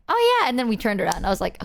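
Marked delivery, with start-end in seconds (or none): 0.1-0.5 high, loud, breezy mock realisation; airy ditzy friend. Zeta suddenly remembers shopping, high and airy. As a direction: pop it out high like just remembering. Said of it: "she's like".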